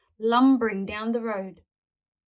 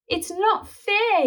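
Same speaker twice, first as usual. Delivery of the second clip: slow, high sleepy whine through a yawn; 11 semitones higher, 3 dB louder, slower than usual. A narrator's reading. Sleepy boy complaining to granny. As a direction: yawn it out tiredly. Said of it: "yawned Spike".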